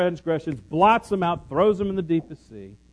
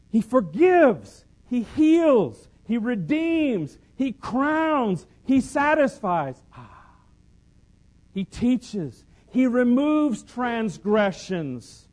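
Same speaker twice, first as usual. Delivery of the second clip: preaching cadence; 6 semitones higher, slower than usual. Acted. Preacher lists God's deeds, ringing.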